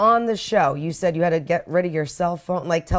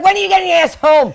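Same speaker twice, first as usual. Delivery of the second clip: nagging wife voice; 11 semitones higher, 8 dB louder than usual. Retold or imitated. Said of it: "She's like".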